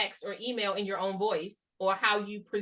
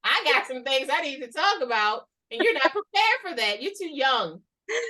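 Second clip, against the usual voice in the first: raised, louder comic rant; mock outburst; 3 semitones higher, 4 dB louder than usual. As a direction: unload it with playful defiance. Acted outburst answering who hurt you.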